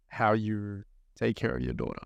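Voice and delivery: normal talking voice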